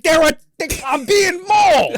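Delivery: high, loud, slow mock wail; wailing at the parents that he's being mauled; desperate protest. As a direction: cry out like you're being eaten alive.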